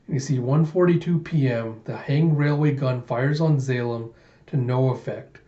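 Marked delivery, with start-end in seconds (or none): none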